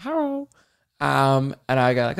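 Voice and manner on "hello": put-on a high, accented "Hello" like "har"; imitating her hello. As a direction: do the receptionist's accented hello, higher. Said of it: "She said".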